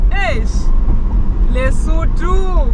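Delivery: loud, high, drawn-out exclamation; excited. Real, not acted. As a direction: call it out in awe. Awed by the view.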